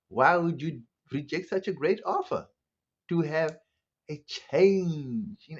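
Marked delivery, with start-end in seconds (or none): none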